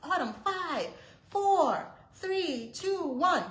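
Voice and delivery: very high, loud screamed countdown; screaming countdown. Retold or imitated. Frantic mother screaming a countdown at her hiding daughter, very high and loud. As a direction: scream each number, getting desperate. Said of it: "I'm screaming at this point".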